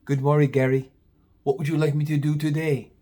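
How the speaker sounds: a slightly put-on voice for an overly polite coworker; light imitation of a quirky, respectful clerk; polite Bennett. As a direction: greet the boss with exaggerated courtesy. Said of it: "That's how he talked".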